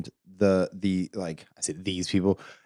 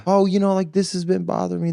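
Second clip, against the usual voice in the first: higher-pitched imitation of someone opening up; Californian sharing feelings; 8 semitones higher than usual. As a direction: pitch it up as a mock confider. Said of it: "you sit down like".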